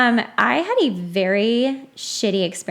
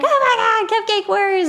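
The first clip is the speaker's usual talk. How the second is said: much higher, laughing gush; gushing fan squeal; 13 semitones higher, 3 dB louder than usual. Retold or imitated. Fans gushing about her win.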